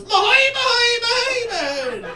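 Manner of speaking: very high, loud squawked gibberish; Doodle Bob cartoon voice. Girl at the bar performing the Doodle Bob cartoon voice, squawked high. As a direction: screech the cartoon scribble chant. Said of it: "And she goes".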